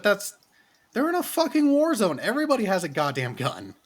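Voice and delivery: high-pitched exasperated objection; incredulous outburst. Real, not acted. Co-host scoffing at fuss over her gun, incredulous outburst. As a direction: protest the obvious, voice jumping way up.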